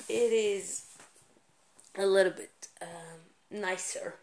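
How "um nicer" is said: ordinary talk, nothing marked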